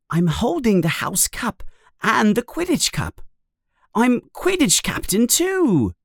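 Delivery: put-on high boyish voice, climbing in pitch; Ron's delighted voice. A narrator's reading. Narrator voices Ron's vision.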